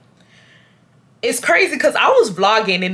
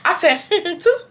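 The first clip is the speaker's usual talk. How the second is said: giggling through a high squeak; laughing; 10 semitones higher than usual. Retold or imitated. Storyteller laughing at her own line. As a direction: laugh it out while quoting yourself.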